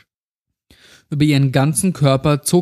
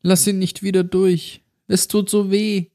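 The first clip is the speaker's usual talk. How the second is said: put-on high, pleading child voice; pained little girl; 6 semitones higher than usual. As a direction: beg like a tortured girl. Gequältes Mädchen bittet um Hilfe. (gequälte Kinderstimme, flehend)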